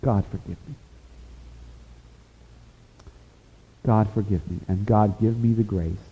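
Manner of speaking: soft, hushed prayer of repentance; heartfelt prayer. Real, not acted. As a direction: pray it quietly, asking forgiveness. Prays aloud.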